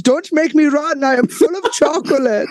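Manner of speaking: lightly voiced Uta, high; a hint of Uta from The Simpsons; Uta impression. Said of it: "Fucking Uta from the Simpsons".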